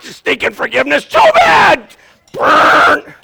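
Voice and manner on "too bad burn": high shouted punchline. Acted. Preacher mock-shouting at reluctant forgiver, loud. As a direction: yell it out, triumphant.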